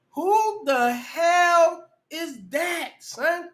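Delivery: slow, high, drawn-out cry of shock; horrified wail. Real, not acted. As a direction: stretch it out like a horrified moan. Horrified at a photo.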